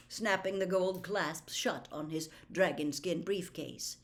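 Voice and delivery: ordinary talk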